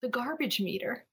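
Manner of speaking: General American with every R in "garbage meter" sounded; accent dropped, General American. Shown as a demonstration. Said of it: "when I say it not in my accent".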